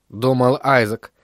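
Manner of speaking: ordinary delivery, nothing marked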